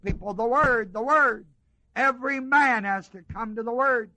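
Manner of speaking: fiery preacher pressing the congregation; preaching at full force. Acted. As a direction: build the sermon to a ringing climax. Pulpit climax on the living Word.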